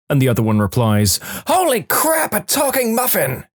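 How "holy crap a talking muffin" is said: very high startled squeal; shocked exclamation. Acted. Muffin hears another muffin talk, shocked high squeal. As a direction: squeal it in comic, high-pitched astonishment.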